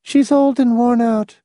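high monotone for a woman character; toneless. A narrator's reading. Miss Rice justifying the plan to Hamilton, toneless high voice. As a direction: keep her high and without expression. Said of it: "she said tonelessly".

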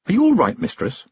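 put-on high, worried young girl. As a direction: ask like an anxious girl. Tiffany asks with concern.

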